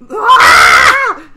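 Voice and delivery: loud, high acted scream. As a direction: scream out like you've been attacked. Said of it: "Gintoki screams".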